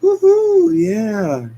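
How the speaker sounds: high, loud woohoo falling; brawl whoop. Acted. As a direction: cheer wildly mid-fight. Sonic cheering in brawl.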